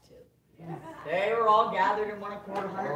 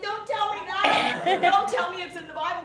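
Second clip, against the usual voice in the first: pleading protest; 8 semitones higher, 4 dB louder than usual. Retold or imitated. Pleading with pastor, high.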